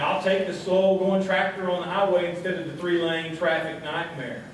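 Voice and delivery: ordinary talk, nothing marked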